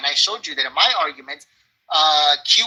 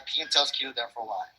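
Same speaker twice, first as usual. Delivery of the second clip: fading; 4 semitones lower, 9 dB quieter than usual. Shown as a demonstration.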